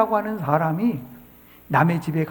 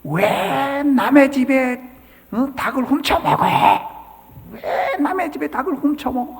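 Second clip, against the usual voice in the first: higher, louder retold yell at a thief; 6 semitones higher, 3 dB louder than usual. Angry owner yells at the chicken thief, mock bellow. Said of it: "고래고래 소리를 지르면서".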